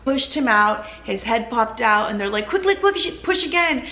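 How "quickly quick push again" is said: urgent staff call, much higher. Retold or imitated. Wife retelling nurses urging one more push, urgent and high. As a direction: hurry her along, pitched high and pressing.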